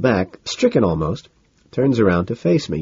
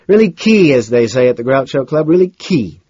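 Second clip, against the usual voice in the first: raised, louder mock-delighted voice; amused club bore; 5 semitones higher, 6 dB louder than usual. A narrator's reading. Carnes quotes the Groucho Club slang, amused. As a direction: swoop up on the catchphrase. Carnes chuckles over the joke.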